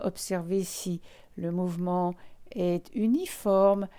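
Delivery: ordinary talk, nothing marked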